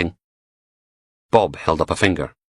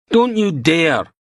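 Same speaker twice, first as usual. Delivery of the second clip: angry outburst; 11 semitones higher, 4 dB louder, slower than usual. Acted. Beattie explodes at Logan.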